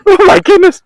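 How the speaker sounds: high, startled gasp of words; shocked. Real, not acted. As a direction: react like you just escaped trouble. Nearly disqualified, shocked.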